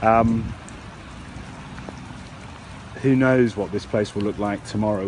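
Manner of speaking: usual speaking voice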